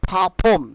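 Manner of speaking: "perform" said pha-phom, r dropped, puffed p; breathy burst on the p of "perform", no r; corrected pronunciation. Shown as a demonstration. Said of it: "Correct pronunciation will be".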